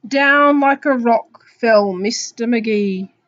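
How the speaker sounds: loud, drawn-out picture-book reading; slow down and build suspense for children; story narration. Acted. Teacher reading of the apple's discovery, slow story narration.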